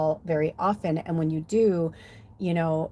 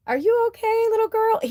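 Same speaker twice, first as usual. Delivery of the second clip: high, sugary drag queen coo; high sweet voice; 5 dB louder than usual. Retold or imitated. Drag queen cooing to a young girl, high and sweet. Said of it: "they said something to me like".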